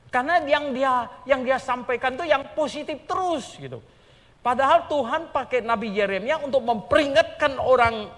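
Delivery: loud, driving pulpit delivery; booming sermon voice; preaching at full force. Acted. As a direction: preach it hard to the congregation.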